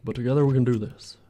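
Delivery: lightly voiced presidential speech; a hint of a statesman rallying the nation, accent slipping; Barack Obama. Modeller rallying Canadians over geometry, light presidential impression. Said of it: "Why is my Barack Obama Canadian Southern?".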